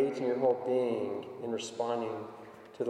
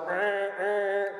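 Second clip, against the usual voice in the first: imitated horn; 7 semitones higher than usual. Imitating a car horn blaring.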